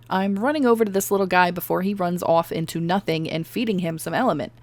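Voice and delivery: usual speaking voice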